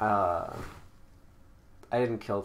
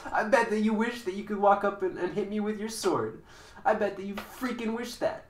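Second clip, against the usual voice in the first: taunting; 8 semitones higher, 5 dB louder than usual. Acted. Taunting opponent in a duel.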